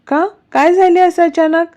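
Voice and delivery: high-pitched, startled questioning; alarmed mother. A narrator's reading. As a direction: ask in sudden, lightly acted alarm. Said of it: "Revati घाबरून बोलतात". (घाबरलेल्या आईचा चढलेला स्वर)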